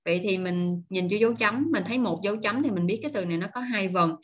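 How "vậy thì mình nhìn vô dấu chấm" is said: normal talking voice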